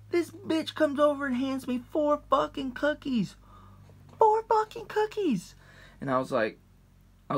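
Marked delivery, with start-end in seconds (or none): 0.1-5.6 high, stunned disbelief; incredulous. Real, not acted. Disbelief at four cookies.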